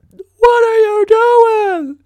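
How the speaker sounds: slow, stretched, very high yell; long exaggerated shout at the crowd; drawn-out shout. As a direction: hold every word out at full volume.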